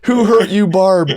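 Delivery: high, loud hammed-up pity; host repeating the co-host's joke with mock pity; mock dramatic sympathy. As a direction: echo the joke with mock concern.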